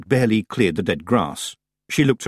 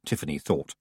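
plain reading voice

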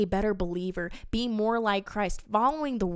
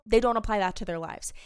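usual speaking voice

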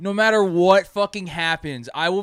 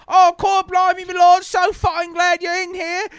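much higher, louder mock British Renaissance lady; fake British accent; 8 dB louder than usual. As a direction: ham up an olde English shopkeeper accent. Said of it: "talking, like, in an accent".

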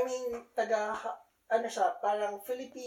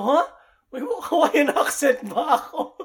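loud, high, laughing disbelief; mock-astonished, raised-voice question; surprised; 7 semitones higher, 13 dB louder than usual. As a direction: retell your own startled comeback. Retells own startled reply to friend.

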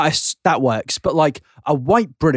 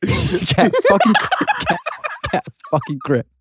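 laughing; 13 semitones higher, slower than usual. Real, not acted. Laughing at co-host's joke.

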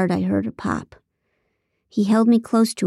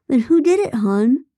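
mock innocent; 6 semitones higher, 4 dB louder than usual. Phil's hammy act continues.

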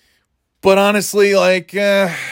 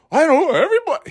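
high-pitched mocking impression; squeaky Tucker Carlson; 11 semitones higher, much faster than usual. Podcaster mocking a TV host, high squeaky voice. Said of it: "He sounds like a fucking".

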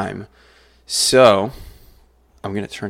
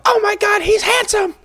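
very high, loud swoon; swooning fangirl; 5 dB louder than usual. Acted. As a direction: squeal it like a starstruck fan. Acting out the fangirls in the scene.

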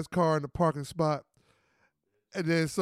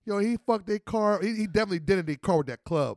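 ordinary delivery

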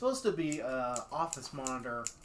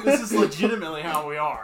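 laughing; 2 semitones higher, 12 dB louder than usual. Real, not acted. Still laughing.

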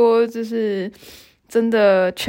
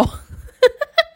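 high rising laugh. Retold or imitated. Boyfriend bursts out laughing.

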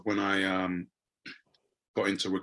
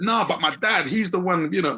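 high, louder insistence on dad; protesting; 8 semitones higher, 5 dB louder than usual. Retold or imitated. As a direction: plead it, voice jumping up.

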